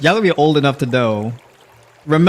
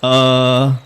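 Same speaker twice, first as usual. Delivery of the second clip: drawn-out, louder "uh"; filler word; 5 dB louder than usual. Shown as a demonstration. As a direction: demonstrate a stalling, held "uh". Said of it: "Those filler words are a tell".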